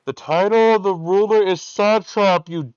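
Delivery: high, very loud drawn-out heckle; far-off heckler hollering at full volume; shouting from a distance. Acted. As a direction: yell it slowly across a big crowd. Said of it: "Someone in the distance".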